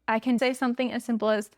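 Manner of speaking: ordinary delivery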